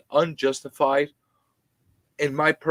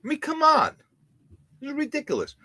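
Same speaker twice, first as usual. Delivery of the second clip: exasperated; faster than usual. Real, not acted. Ranting about landlord taxes.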